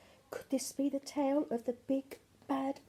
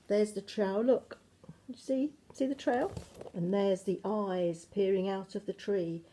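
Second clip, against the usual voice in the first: plain reading voice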